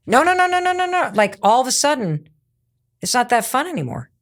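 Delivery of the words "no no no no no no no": high, rushed string of no's; flustered denial. Acted. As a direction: panic to take it back, much higher.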